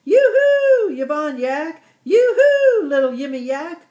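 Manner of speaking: high, loud, excited yoo-hoo; excited yell. A narrator's reading. Yalta Yak calling excitedly to family at the door, loud high yell. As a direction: call out with joy at arriving home. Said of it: "yelled Yalta Yak excitedly".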